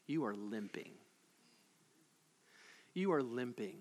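soft and low, deadly serious; hushed repeat. Acted. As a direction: drop to a soft, low, grave statement.

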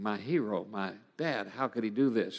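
low, very soft inner thought; quiet, stunned dismay; hushed disbelief. Retold or imitated. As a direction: say it under your voice, wounded. Retold thought on father's betrayal.

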